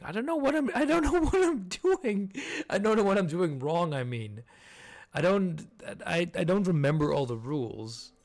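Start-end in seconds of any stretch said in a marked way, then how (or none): 0.0-7.1 high, loud exasperated outburst; frustrated squeal. Real, not acted. Frustrated at puzzle, laughing.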